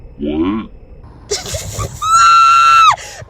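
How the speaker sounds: big shrieking burst of laughter; cackling shout of a laugh; loud laugh. Real, not acted. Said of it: "change my tone real quick".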